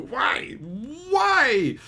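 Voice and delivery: high-pitched exasperated wail; acted frustrated outburst; mad brain. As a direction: cry out why in rising disbelief. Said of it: "my brain gets mad".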